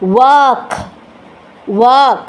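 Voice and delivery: ওয়ার্ক as "wak", r left silent; ওয়ার্ক said twice, no r at all; correct pronunciation. Shown as a demonstration. Teacher repeating the right sound of ওয়ার্ক for viewers. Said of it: "প্রোনাউন্সিয়েশনটা হচ্ছে".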